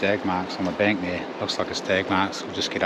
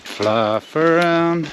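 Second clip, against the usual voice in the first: drawn out slowly; 8 semitones higher, 6 dB louder, much slower than usual. Real, not acted. Drawn out playful.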